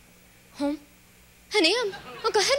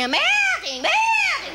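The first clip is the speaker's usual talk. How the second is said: high, loud drawn-out wail; witch's wail; 14 semitones higher, 5 dB louder, slower than usual. Acted. Melting witch wails, high and loud. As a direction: wail it out in a shriek.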